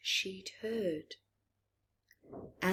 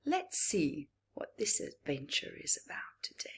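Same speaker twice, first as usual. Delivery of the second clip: whisper; 6 dB quieter than usual. Real, not acted. Teaser aside to the children.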